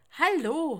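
loud, high rising call into the phone. A narrator's reading. Sebastian calling into a silent phone, loud rising call. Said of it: "ruft Sebastian".

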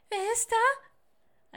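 very high, loud, slow squeak; frightened peep. Acted. Little owl calls to the knock at the door, frightened peep. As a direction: squeak it like a startled owlet. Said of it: "machte erschreckt einen Hüpfer und piepste".

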